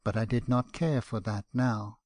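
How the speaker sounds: usual speaking voice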